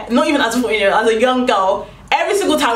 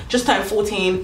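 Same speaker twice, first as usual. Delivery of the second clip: ordinary delivery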